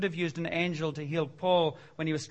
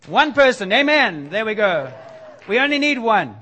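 loud, high, rejoicing; exultant preaching; 3 semitones higher, 10 dB louder than usual. Real, not acted. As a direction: celebrate each stand-up, loud and high. Preacher exults, much louder.

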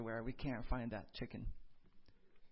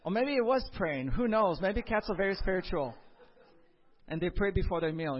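excited aside; 5 semitones higher, 9 dB louder than usual. Real, not acted. Joking aside about praying cats.

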